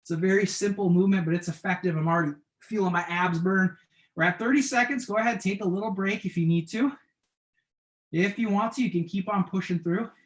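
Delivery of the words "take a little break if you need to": normal talking voice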